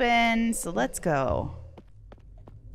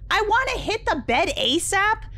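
excited yell; 13 semitones higher, 6 dB louder than usual. Real, not acted. Excited outburst while playing.

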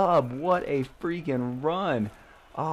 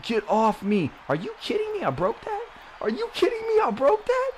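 gleeful taunting; 12 semitones higher than usual. Real, not acted. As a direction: crow at the defenders who can't keep up. Breaking tackles, taunting defenders.

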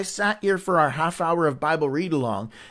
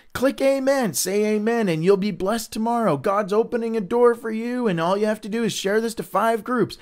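mock spam post; 6 semitones higher than usual.

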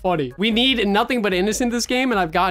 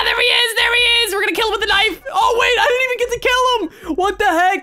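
very high, loud excited yelling; squealing, worked-up gamer shouts; frantic shouting; 12 semitones higher, 4 dB louder than usual. Real, not acted. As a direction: yell it mid-chase in a panic. Frantic chase of murderer.

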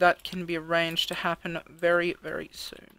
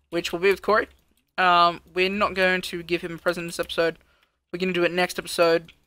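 louder, upbeat sign-off to viewers; energetic outro; 7 dB louder than usual. Acted. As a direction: wrap up the episode with energy. Loud energetic episode outro.

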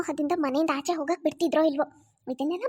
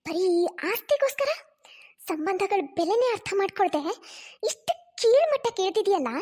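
scolding mother. Acted. Mother scolds scheming daughter.

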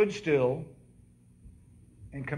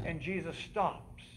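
normal talking voice

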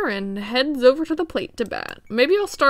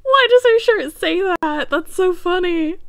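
amused laughing; 8 semitones higher, 5 dB louder than usual. Real, not acted. Amused at the shirt.

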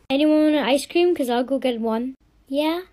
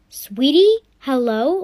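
ordinary talk

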